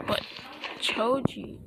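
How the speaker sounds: soft, pitiful, nearly whispered plea; pathetic voice. Retold or imitated. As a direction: beg him quietly and pathetically. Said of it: "In that pathetic type voice".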